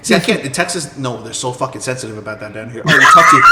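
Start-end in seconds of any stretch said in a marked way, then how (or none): 2.9-3.4 very high and loud mocking whine; mock Texan complaint. New Yorker mocking Texans, very high and loud. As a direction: mimic the touchy Texans. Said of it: "they're like".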